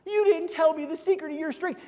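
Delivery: high-pitched mock tantrum; hammed-up indignant complaint; hissy fit. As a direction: whine it out like someone throwing a fit. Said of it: "she has a hissy fit".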